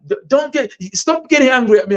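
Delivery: playing someone pleading with an angry friend; a touch of mock exasperation; mock exasperated plea. Person scolding an angry friend.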